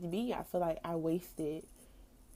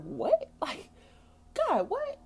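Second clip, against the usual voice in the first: high, loud incredulous "what?"; baffled disbelief; 6 dB louder, much slower than usual. Retold or imitated. Telling of her shock at God, high baffled disbelief. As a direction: squeal it in mock disbelief.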